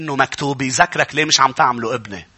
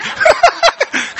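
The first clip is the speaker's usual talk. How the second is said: sudden high-pitched laugh; 4 dB louder than usual. Real, not acted. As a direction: burst out laughing mid-story. Laughs retelling the story. (ضحكة عالية)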